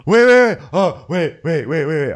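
loud, high mock-urgent burst, then easing; hammed-up calling out to halt everyone; urgent interruption. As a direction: cut in as if suddenly remembering. Acted interruption by [name].

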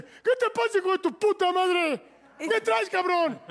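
hammed-up road-rage yell; imitating an angry fighter. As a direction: bark it up high like the furious driver. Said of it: "Se baja así y me dice".

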